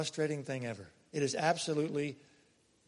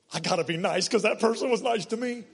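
louder, higher, earnest inner voice; inner resolve; 6 semitones higher, 8 dB louder than usual. Retold or imitated. Preacher acting out his own thought about a kind teenager, earnest and raised.

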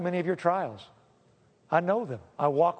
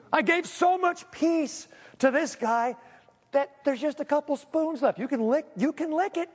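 mock God voice; 9 semitones higher than usual. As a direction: play God offering the last spoons of ice cream. God voiced, offering leftover spoons.

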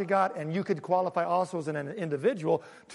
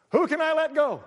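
hammed-up president; 9 semitones higher, 5 dB louder than usual. Imitated again, higher and louder.

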